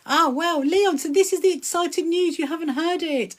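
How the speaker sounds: high, lively news-breaking voice; high-pitched, eager reveal; excited announcement. Real, not acted.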